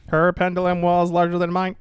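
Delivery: high, loud alien voice. Acted. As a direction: boast like a cone-headed alien. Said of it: "Like one of the cone heads".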